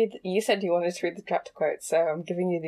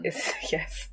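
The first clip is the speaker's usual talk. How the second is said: whispering; 6 dB quieter than usual. Real, not acted. Whispered laughing reply.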